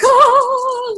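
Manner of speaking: sudden high yelp of excitement; a squeal like an overjoyed pet; excited dog. Retold or imitated. Owner comes home, dog greets her with an excited squeal. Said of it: "like, all excited".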